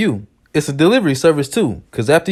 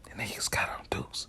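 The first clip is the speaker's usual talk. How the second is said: hushed secret whisper; whispered warning; 22 dB quieter than usual. Retold or imitated. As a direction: murmur it like a secret. Passing the warning on.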